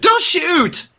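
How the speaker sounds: high, desperate begging; a terrified man facing armed strangers; pleading for life. Acted. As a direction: beg them not to shoot, hands up. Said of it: "puts his hands up and is like".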